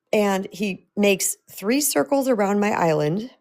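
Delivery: ordinary talk, nothing marked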